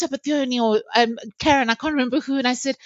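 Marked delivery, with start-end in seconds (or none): none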